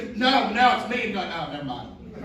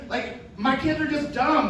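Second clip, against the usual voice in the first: ordinary talk, nothing marked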